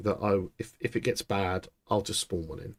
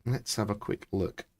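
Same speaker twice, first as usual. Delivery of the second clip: usual speaking voice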